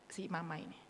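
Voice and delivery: ordinary delivery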